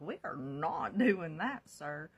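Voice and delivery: loud, drawn-out telling-off; retold firm refusal. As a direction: put your foot down, slow and loud.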